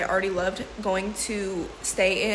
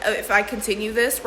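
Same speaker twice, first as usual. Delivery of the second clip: ordinary talk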